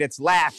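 "Latch" said short with a hard "tch"; stressed Latch. Shown as a demonstration. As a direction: punch the word "Latch" like a lesson. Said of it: "Lock it in your mind".

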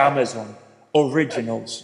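low British trailer announcer; deep, British-accented film-trailer read; super-deep movie voice. Shown as a demonstration. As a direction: drop into a deep cinematic voice. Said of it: "como con la voz súper, súper profunda".

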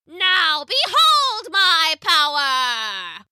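very slow, high, drawn-out proclamation; long theatrical witch cry; triumphant witch. A narrator's reading. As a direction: proclaim it with wicked glee. Witch proclaims her magic.

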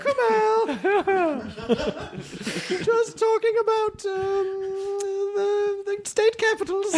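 put-on high, laughing old lady; a sweet old lady covering up. Emily as an old lady telling Kumail nothing happened, high pitched. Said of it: "turned her into an old lady".

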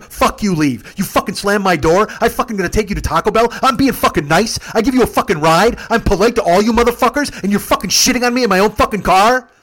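high, loud outraged shouting; furious re-enacted tirade. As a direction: explode with wronged indignation, building to a question. Teller explodes at passengers, retold.

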